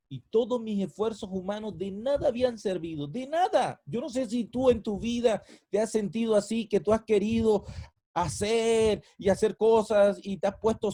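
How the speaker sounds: loud, high, impassioned preaching; full-force preaching. Acted. Pastor challenging the congregation, loud impassioned preaching. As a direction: cry it out to the congregation.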